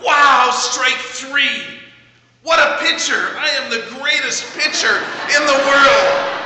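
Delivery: high, loud delighted shout, first words drawn out slow; excited cry. Retold or imitated. Pastor voicing the boy cheering his own strikeout, high loud delight. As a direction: burst out with joy at the miss. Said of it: "He cried out".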